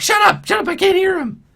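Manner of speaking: very high, loud goblin shriek at the crowd; goblin yelling. Acted. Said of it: "he's like".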